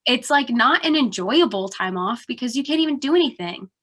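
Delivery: ordinary delivery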